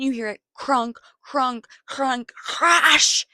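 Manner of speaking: loud, high imitated crunching crash; crash noises. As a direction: mimic the van smashing down the cliff.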